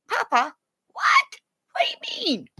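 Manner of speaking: high, whispered pleading pup voice; voiced dog whisper. Owner voicing dog at bed's end, high whisper. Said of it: "He's at the end of the bed going".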